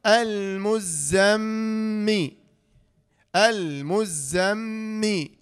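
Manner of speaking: slow "المزمي" with a long hummed doubled m; held ghunna. Shown as a demonstration. Teacher spelling the syllables, held nasal m in "المزمي". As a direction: hum on the m of "المزمي", then release to mi. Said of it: "ميم غنة شدة كسرة".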